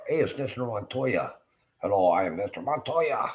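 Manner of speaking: loud, high-pitched mock Cuban accent; Cuban inflection. Streamer mimics a Mister Montoya greeting, exaggerated Cuban accent. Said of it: "Cuban inflection in there".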